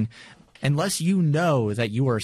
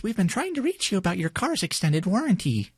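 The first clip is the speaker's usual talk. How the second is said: lightly mocked robocall pitch, lifted higher; robocall imitation; 9 semitones higher than usual. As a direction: imitate a scam warranty call in a higher voice. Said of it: "we've all gotten those".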